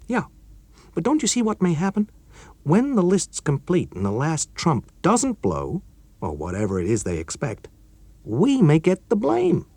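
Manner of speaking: nervous colleague fearing blame; worried young engineer; Chuck, worried. Acted.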